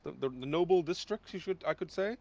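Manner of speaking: high, strained soldier voice; Derek voice. Acted. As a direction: report proudly in a put-on high voice.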